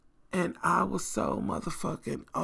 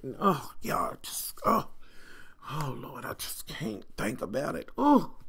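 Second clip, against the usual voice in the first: tearful whisper; 2 semitones lower than usual. Real, not acted. Overcome remembering the fang.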